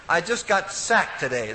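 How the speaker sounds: ordinary talk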